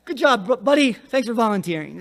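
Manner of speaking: mock praise. Sarcastic Peter to John.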